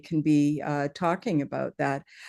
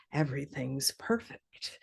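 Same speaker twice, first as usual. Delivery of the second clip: much softer, lower aside; quoting Marco; 4 semitones lower, 10 dB quieter than usual. Retold or imitated. Quoting Marco to thank a newcomer, softly. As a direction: drop the voice right down, soft and low. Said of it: "as Marco would say".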